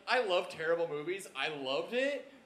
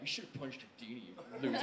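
quiet muttered jeer, softer; soft mocking taunt; 7 semitones lower, 11 dB quieter, faster than usual. Friend goading the narrator's pal, quietly mocking. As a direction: taunt him low under your breath. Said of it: "He's like".

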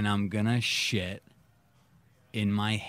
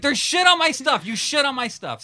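outraged; 6 dB louder than usual. Retold or imitated. Retold discovery of shit.

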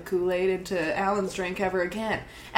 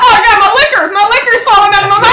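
high, loud frantic wail; hammed-up panic; 11 semitones higher, 11 dB louder, much faster than usual. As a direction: cry out in comic distress. Sandra's tongue falls out.

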